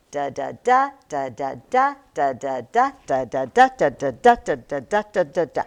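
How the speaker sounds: rapid "da, da, da" groups, every third "da" punched; galloping "da, da, da" with the last one stressed; three-beat meter. Shown as a demonstration. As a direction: chant weak-weak-STRONG on "da, da, da". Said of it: "The other ones have three".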